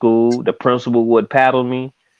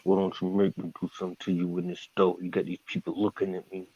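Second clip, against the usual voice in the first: soft, low mock hiss through the teeth; mother hissing a threat in a store, much softer; mama's scary hiss; 3 semitones lower, 14 dB quieter than usual. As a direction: threaten him under your breath in the store. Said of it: "and she'll just".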